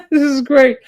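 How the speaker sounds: high giggly burst of delight; laughing. Real, not acted. Vlogger cracking up at the cereal clip, laughing. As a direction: laugh it out, tickled.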